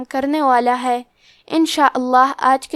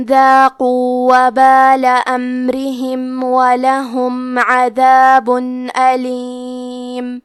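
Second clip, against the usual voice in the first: slow, drawn-out melodic tajweed recitation; Quran recitation; much slower than usual. Shown as a demonstration. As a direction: intone every verse slowly and evenly. Recites Quran verses slowly. (آہستہ، سریلی قرآن کی تلاوت)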